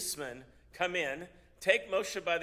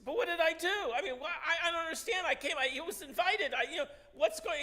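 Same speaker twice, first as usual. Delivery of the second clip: much higher, louder bewildered protest with a nervous laugh; panicked pleading; 12 semitones higher, 4 dB louder than usual. Acted. Old teacher begging the priest to explain, high and panicked. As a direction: plead in disbelief, voice shooting up. Moshe facing the priest's threat.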